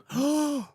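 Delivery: sharp, high mock gasp; hammed-up gasp of shock. Mock gasp in retold scene.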